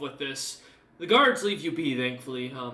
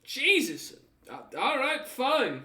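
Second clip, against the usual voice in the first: higher, startled then grumbling guard; 11 semitones higher, 3 dB louder, slower than usual. Acted. Guard nearly stabbed, startled then annoyed, higher. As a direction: jump back and grumble. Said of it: "pulls his hand back and goes".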